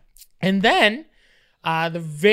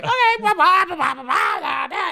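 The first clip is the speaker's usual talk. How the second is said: high, louder blah blah; dismissive babble; 10 semitones higher, 4 dB louder than usual. Retold or imitated. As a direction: brush it off with nonsense. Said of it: "I was just like".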